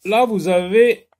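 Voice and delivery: normal talking voice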